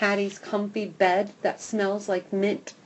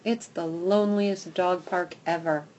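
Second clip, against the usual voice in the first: plain reading voice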